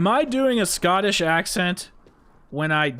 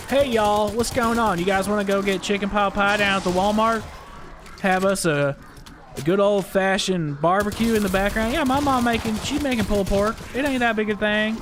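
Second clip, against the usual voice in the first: Southern American accent; 6 semitones higher than usual. Shown as a demonstration. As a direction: play a folksy Southerner, pitch rising. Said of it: "Southern American accents like".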